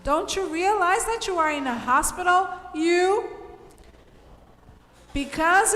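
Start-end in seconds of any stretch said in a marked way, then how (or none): 0.0-2.5 quick, raised emphatic question; emphatic reread. Shown as a demonstration. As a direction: push the whole question out fast with stress on realize. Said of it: "So we are emphasizing".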